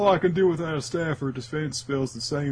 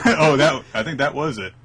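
usual speaking voice

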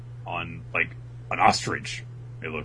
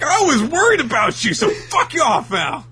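very high, loud furious shout; angry Chopper; 8 dB louder than usual. Acted. Chopper shouting at the buried dude, very high furious shout. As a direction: yell it while held back. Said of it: "It's like".

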